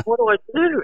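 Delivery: high, panicky plea; high-pitched, helpless panic; panicked question. Retold or imitated. Panicking before his heat.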